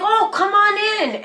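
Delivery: a high, sugary, fake-nice invite; a hint of sweet-talking ex; mock-friendly him. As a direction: lightly imitate his fake charm. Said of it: "and he's like".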